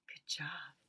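unvoiced, low hushed praise; whisper. Real, not acted. Teacher whispering praise after the meows.

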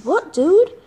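ordinary delivery

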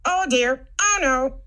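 high, loud frightened robot; scared robot. Acted. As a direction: panic with rattling fear. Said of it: "The robot was so scared".

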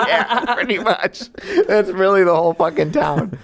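high-pitched, laughing agreement; cracking up while agreeing; real laughing. Real, not acted. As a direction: talk through your laughter. Cracking up at co-host's joke.

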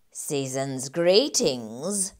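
low, slow oily greeting; lightly voiced sneaky rat; sly rat landlord. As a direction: slither the words out like a rogue. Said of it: "he says".

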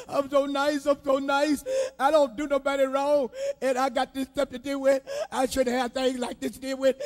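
fast, self-pitying mock lament; mock complaining. Preacher mocking a complainer to the congregation, fast whining. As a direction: rattle it off like a moaning complainer. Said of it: "you sit up there and complain and complain".

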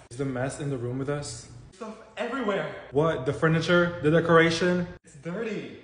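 ordinary talk

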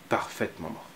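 soft whispered aside. A narrator's reading. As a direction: breathe it like a secret. Narrator whispers.

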